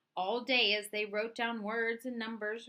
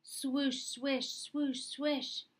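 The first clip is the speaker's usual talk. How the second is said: higher, airy swishing sounds; skirt swish imitation; 5 semitones higher, much slower than usual. Blind boy mimicking ladies' skirts rushing to market, swishing sounds. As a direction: mimic long skirts hurrying by.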